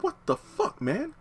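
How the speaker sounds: falsetto mock disbelief; very high-pitched incredulous protest; Tom. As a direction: imitate shock in a high, softened squeak. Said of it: "Tom's like".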